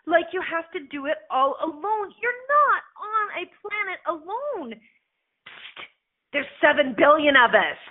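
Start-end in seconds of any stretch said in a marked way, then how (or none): 4.7-5.8 soft unvoiced psst to get attention; whispered psst. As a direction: lean in with a secret psst. Nudging listener conspiratorially.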